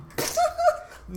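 high, loud laugh; burst of laughter. Real, not acted. Friend laughing at the stabbing story, burst of laughter. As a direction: let it burst out.

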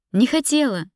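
high, loud protest; heated cry of denial; shouting back. A narrator's reading. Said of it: "закричала я в ответ".

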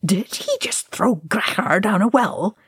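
incredulous. A narrator's reading. Ori reacting to the crash in the well, incredulous. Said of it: "Ori demanded incredulously".

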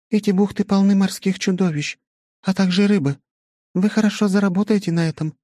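high goddess voice, calmly explaining. A narrator's reading. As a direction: speak as a young goddess.

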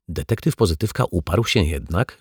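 usual speaking voice

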